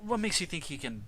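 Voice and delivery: father challenging the professor; doubtful father. Acted. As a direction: push back on her claim.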